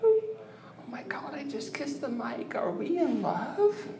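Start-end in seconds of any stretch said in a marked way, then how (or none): 0.9-2.5 whispering. Real, not acted. Whispering bit continues.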